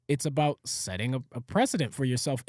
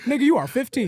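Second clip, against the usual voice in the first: laughing; 9 semitones higher, 6 dB louder, faster than usual. Real, not acted. Laughing comeback.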